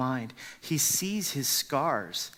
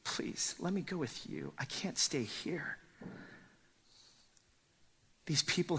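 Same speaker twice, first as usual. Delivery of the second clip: whispered begging. Acted. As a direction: plead in a near whisper. Preacher voices the man begging.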